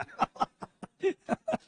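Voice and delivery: helpless breathy laugh; real amused laughter. Real, not acted. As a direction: burst out in a high laugh. Teacher laughs at the class's answers.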